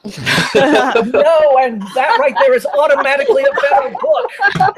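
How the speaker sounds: loud, high-pitched, laughing through the words; laughing declaration. Real, not acted. Booktuber seizing on a robot love-triangle joke, laughing declaration. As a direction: crow it over the laughter.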